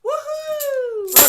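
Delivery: high whooping cheer. Real, not acted. Cheering for member.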